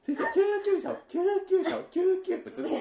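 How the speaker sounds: high, loud mock panic; imitating the clip. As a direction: cry 先生、救急車を like the clip. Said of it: "セリフ途中でぶった切られてるんだよね".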